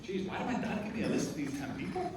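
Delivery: lightly hushed, dismayed muttering to himself; a hint of his younger self's dismay at the list. Son shocked that dad's contacts hate him, quietly dismayed. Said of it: "I was like".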